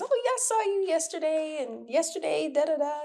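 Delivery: high, bright mock voice of an acquaintance; imitating someone who swears they saw you.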